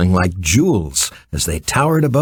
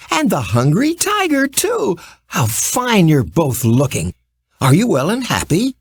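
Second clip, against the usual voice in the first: put-on high, bright girl's voice calling out gladly; a young girl greeting an old friend with joy; glad little girl's cry; 7 semitones higher than usual. A narrator's reading. Little Kansas girl greets the Cowardly Lion, high and glad. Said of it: "cried the little Kansas girl".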